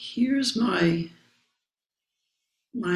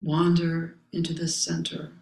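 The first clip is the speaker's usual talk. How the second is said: solemn text recitation. Acted. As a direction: recite each phrase with space around it. Reading the Zen text aloud.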